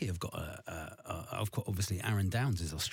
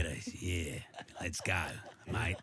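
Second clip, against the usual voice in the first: low West Country-Australian character voice; 7 semitones lower, 6 dB quieter than usual. Acted. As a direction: rehearse Ange in deep West Country Australian. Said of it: "Have to work on the Australian".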